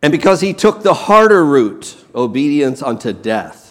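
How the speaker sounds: normal talking voice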